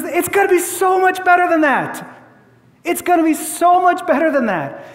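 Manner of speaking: high, bright-voiced and excited; eager promise. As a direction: promise it with delight. Jesus promising something greater.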